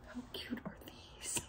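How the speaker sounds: hushed whisper; whispered gushing. Real, not acted. Showing viewers tiny cups, whispered gushing. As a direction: whisper it very softly in adoration.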